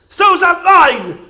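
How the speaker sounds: loud, slow, through laughter; laughing. Real, not acted. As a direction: try to say it while cracking up. Judge cracking up.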